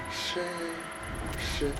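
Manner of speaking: ordinary talk, nothing marked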